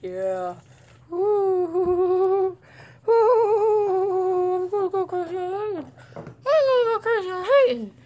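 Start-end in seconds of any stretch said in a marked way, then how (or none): 1.1-7.9 much higher, louder teasing; high-pitched playful shout; playful excited. Real, not acted. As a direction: rile up the dog. Playing with dog.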